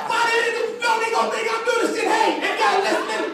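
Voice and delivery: high, alarmed disowning of a caller; panicked outburst. Acted. Comedian disowns friend on tapped phone, panicked. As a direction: jump up high in mock panic.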